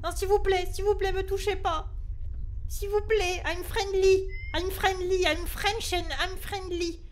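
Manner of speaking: high, repeated begging; frightened, high-pitched appeal; panicked pleading. Real, not acted. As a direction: plead for mercy with an attacker. Pleading with game monster in panic.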